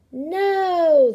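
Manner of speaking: long, high, loud crowd yell. A narrator's reading. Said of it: "the crowd yelled".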